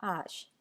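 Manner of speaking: teacher demo of आश; French letter H. Shown as a demonstration. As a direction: model आश for H. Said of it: "H का उच्चारण होता है आश".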